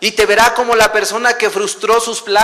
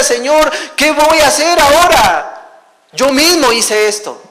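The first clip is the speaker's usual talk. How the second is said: raised, slower, pleading cry; anguished cry to God; 7 semitones higher, 6 dB louder than usual. Retold or imitated. Father crying out to God over his son, anguished and raised. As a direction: cry out to the Lord in distress. Desperate prayer recalled aloud.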